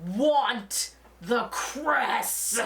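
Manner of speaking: drawn-out, very slow insistence on "want the cress"; mock-stubborn slow demand. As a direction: stretch every word like a sulky dad. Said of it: "And he's like".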